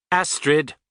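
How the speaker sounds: cold, brisk answer; said coldly. A narrator's reading. Woman answering her radio, cold. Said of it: "she said coldly".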